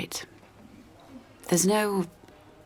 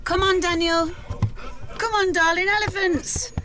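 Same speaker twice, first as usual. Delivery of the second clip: called out higher and louder; coaxing call; 4 dB louder than usual. Acted. Mum calls Daniel to march with the elephants, high loud coaxing call. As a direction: call him over, high and loud, to join in.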